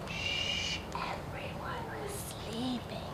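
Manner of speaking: hushed storybook whisper; shh, a soft bedtime whisper. Acted. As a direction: whisper so nobody wakes. Storyteller hushes for sleeping house.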